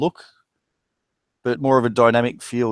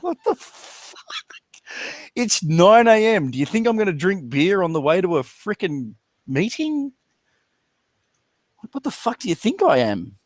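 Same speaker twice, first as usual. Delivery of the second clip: incredulous; 7 semitones higher than usual. Retold or imitated. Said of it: "I was just like".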